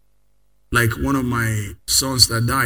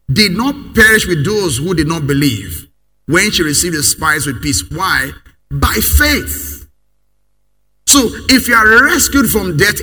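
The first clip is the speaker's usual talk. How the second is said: loud, higher scripture read that keeps pushing; full-force preaching; 4 semitones higher, 6 dB louder than usual. Acted. Pastor reading Hebrews to the congregation, forceful preaching. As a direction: hammer the verse home.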